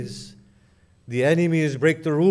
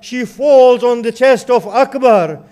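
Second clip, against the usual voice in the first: wailing lament; 7 semitones higher, 11 dB louder than usual. Acted. Zainab mourning over Akbar's body, loud keening wail. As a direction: wail it like a grieving aunt.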